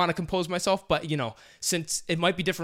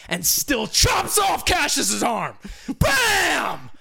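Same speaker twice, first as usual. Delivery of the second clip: much higher, loud shout; excited shout; 10 semitones higher, 5 dB louder, much slower than usual. Real, not acted. Host retells the arm chop to co-host, shouting. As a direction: yell it out at the climax.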